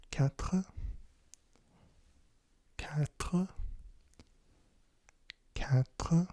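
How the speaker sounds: "quatre" with a throaty, unrolled R; guttural French R. Shown as a demonstration. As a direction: make the R of "quatre" in the back of the throat. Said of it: "saying his R's like that".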